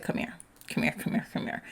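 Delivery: soft, unvoiced urging to come back; hushed beckoning. Spirit pulling you back for your protection, hushed beckoning. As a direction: whisper it as a coaxing call.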